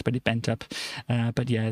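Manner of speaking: normal talking voice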